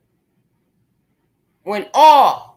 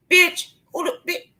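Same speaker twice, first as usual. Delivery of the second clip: incredulous outburst; 7 semitones higher than usual. Real, not acted. Outraged at someone crying after talking.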